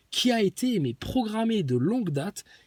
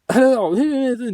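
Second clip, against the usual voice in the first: loud interruption; 6 semitones higher, 8 dB louder than usual. Real, not acted.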